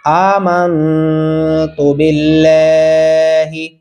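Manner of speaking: आमन्तु बिल्लाही slowly, with short तु; slow chanted model of आमन्तु बिल्लाही; joined recitation. Shown as a demonstration. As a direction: stretch aa and laa, keep तु short in आमन्तु बिल्लाही. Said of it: "ता को भी नहीं खींचना".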